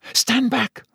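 high urgent call through the glass. Acted. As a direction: warn him off, sharp and high. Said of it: "Hermione called to him".

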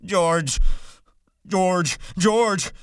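Lenny crying out for George, rising each time. A narrator's reading. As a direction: call out for George in despair. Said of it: "And he cried".

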